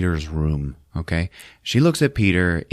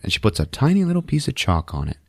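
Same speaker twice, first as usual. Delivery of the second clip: ordinary delivery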